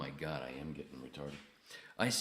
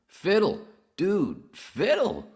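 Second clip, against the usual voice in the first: high, loud, drawn-out exclamation; loud surprise; 14 dB louder, slower than usual. Real, not acted. Host spotting Fiddle in chat, loud high surprised shout. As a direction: call the name out high and stretched.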